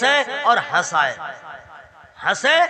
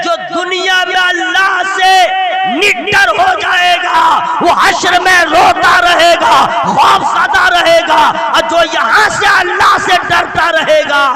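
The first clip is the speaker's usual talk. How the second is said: loud, rising sermon voice, hammering each line; preacher pleading with the crowd at full force; fiery preaching; 7 semitones higher, 9 dB louder than usual. Acted. A preacher thunders to the gathering about crying.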